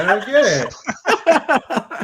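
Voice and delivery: slow, high, laughing bafflement; mock confusion. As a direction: play dumb through a laugh. Mock-confused joke about Wings.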